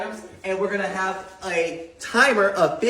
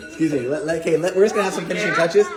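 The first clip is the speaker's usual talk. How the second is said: ordinary talk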